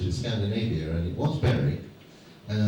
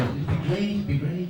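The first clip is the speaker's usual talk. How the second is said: mock enthusiastic; 7 semitones higher than usual. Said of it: "You said".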